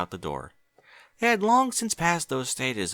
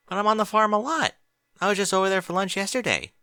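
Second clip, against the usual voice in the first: higher, louder Twilight voice, puzzled; Twilight's voice; 10 semitones higher, 4 dB louder than usual. Acted. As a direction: play Twilight, a little confused. Narrator voicing Twilight.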